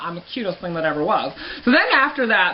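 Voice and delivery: ordinary delivery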